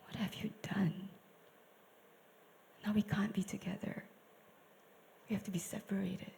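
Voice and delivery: soft, broken whisper; sorrowing God, whispered; grieving whisper. Shown as a demonstration. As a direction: whisper it in heartbreak.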